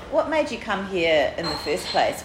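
usual speaking voice

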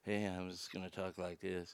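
very low, hushed mumbling impression; Steven Seagal. Host mocking how an action star talks, hard-to-understand impression. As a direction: drop far down and talk very softly, imitating him. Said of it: "Because Steven Seagal, you, you can't understand".

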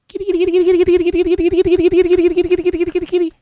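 high, loud sing-song kitty call; creepy sing-song call. Retold or imitated. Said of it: "no one can hear you go".

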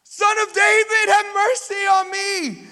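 high, loud, drawn-out cry for mercy; desperate shout. Acted. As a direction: call out over a noisy crowd like a begging man. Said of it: "he starts to shout".

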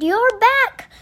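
high, loud exclamation of relief; excited cry. A narrator's reading. Percy seeing Kevin is back, loud excited cry. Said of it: "he cried".

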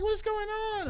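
lightly voiced confused colleague. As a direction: play the puzzled coworker.

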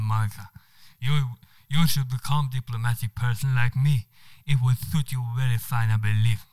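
charming Spanish man with a put-on accent. Spanish diplomat charming the woman on the train, Spanish accent. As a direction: play the suave Spanish diplomat. Manuel flatters Monica.

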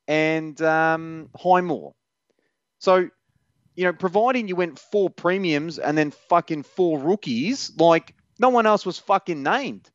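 rip into him, furious; swearing tirade. Real, not acted. Ranting at commenter's question.

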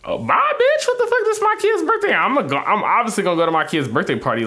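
high-pitched outraged protest, settling as it goes; indignant outburst. Real, not acted. Father rejecting an ultimatum over his kid's birthday, indignant. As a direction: burst out sky-high, then come down.

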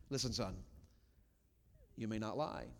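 firm, measured talk to a son; calm reproof. Shown as a demonstration. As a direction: correct your child without raising your voice. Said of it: "I'm reproving".

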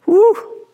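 sharp high breathy whew letting off steam; high exhale whew. Real, not acted. Pastor catching his breath after preaching, high whew. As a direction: blow out the excitement in one high whew. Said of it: "I'm getting worked up here".

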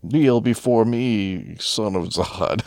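very slow, grand villain command; hammed-up Zod decree, dragged out; slow theatrical villain menace. Quoting Zod villain line.

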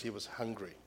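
underplay it, letting the voice fall away; understated. Shown as a demonstration. Preacher showing how the Bible underplays words, soft and understated. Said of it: "Sometimes the Bible underplays words".

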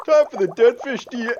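high choppy broken-up line; cutting-out imitation. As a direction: imitate a glitchy call. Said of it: "You were, like, cutting out there".

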